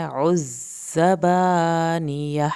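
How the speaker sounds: "عزبانیہ" very slow, firm z, long a. Shown as a demonstration. Teacher to students: very slow recitation. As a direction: press the z in "عزبانیہ". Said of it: "زا کو سختی سے ادا کریں".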